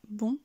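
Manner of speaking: BON with the voice going up; rising tune. Shown as a demonstration. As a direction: let bon climb like an upward arrow. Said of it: "Donc le son monte et la voix aussi".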